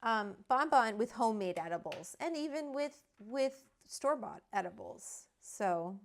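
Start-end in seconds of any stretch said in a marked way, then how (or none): none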